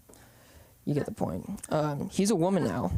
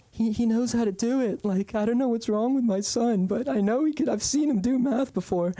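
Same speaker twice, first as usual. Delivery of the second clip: higher-pitched mom pleading her son's case; put-on higher voice of an insistent mom; worried mom; 6 semitones higher than usual. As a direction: imitate a mother protesting to the teacher. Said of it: "my mom had to go".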